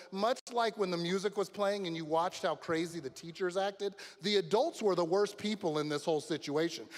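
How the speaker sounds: ordinary talk, nothing marked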